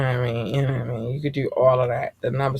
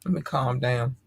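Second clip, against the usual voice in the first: usual speaking voice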